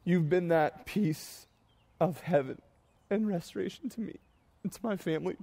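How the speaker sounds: struggling to speak through real crying; tearful. Real, not acted. Pastor thanking his congregation through tears, choked up. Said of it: "My voice gets high".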